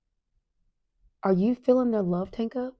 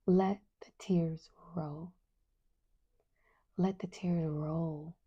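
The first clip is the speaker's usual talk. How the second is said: slow, hushed and soothing; gentle reassurance; 2 semitones lower, 7 dB quieter, slower than usual. Real, not acted. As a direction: softly give permission, drawing out each word. Soothing listeners about crying.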